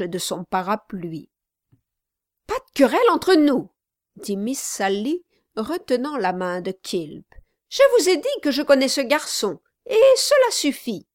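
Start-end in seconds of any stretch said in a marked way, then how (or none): 2.5-3.7 higher, louder, fast command; firm intervention. Acted. Sally stopping Quilp striking her brother, sharp and loud. 7.7-11.1 higher, louder, fast command; firm intervention. Acted. Sally stopping Quilp striking her brother, sharp and loud. Sally cuts the argument short.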